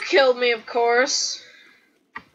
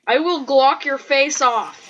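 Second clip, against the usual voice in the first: usual speaking voice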